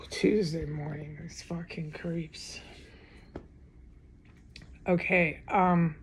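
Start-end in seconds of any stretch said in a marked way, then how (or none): 0.1-2.6 sigh, then whisper it slowly with contempt; hushed, slow, disgusted aside; bitter whisper. Real, not acted. Woman whispering curses at her tormentors, slow and bitter. Muttering at neighbours after sigh.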